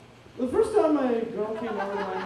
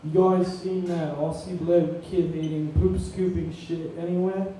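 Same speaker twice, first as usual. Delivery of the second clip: Australian accent. Shown as a demonstration. Comic trying out an Australian accent on the crowd, Australian accent. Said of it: "I just wanna do an Australian accent".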